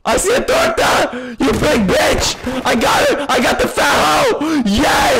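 real throat-straining shouts; screaming. Real, not acted. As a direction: holler in triumph, drawing words out. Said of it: "I'm screaming too loud".

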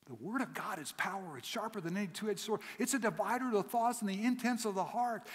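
rising, fervent preacher's build; preaching. Acted. Preacher exalting the word of God to the church. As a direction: swell toward it changes the world.

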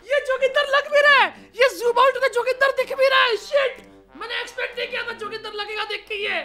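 high, amazed voice rising into a laugh; real surprise at how right it looks; amazed disbelief. Real, not acted.

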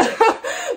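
short high giggle bursting out; a sudden laugh breaking in; quick laugh. Real, not acted. Laughing at herself as she does the maths. Realising how old the book is.

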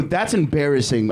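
ordinary talk

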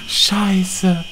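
ordinary talk

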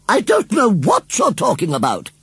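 lady shouting at the scarecrow; indignant. A narrator's reading. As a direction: huff at him furiously.

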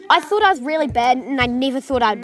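loud, high, thrilled; excited shouting. Real, not acted. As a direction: shout it out after winning. Celebrating the win.

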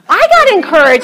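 high, loud, delighted admission; light up as you say it; excited. Real, not acted. Preacher telling the church about herself, excited. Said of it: "excited self-remark".

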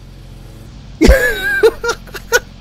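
high, squealing burst of laughter; real high-pitched laughing fit; cackling. Real, not acted. Laughing at the green car crash.

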